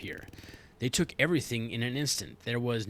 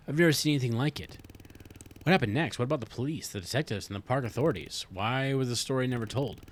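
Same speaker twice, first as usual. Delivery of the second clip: normal talking voice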